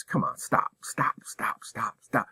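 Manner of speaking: whispered, repeated stop. Real, not acted. Principal urging staff to stop making excuses, whispering. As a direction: hush it down to a plea.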